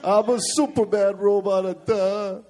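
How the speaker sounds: dopey robot, slow and dull; dumb robot voice. Pretend. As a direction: play a slow-witted robot sidekick. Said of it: "Jersey robot".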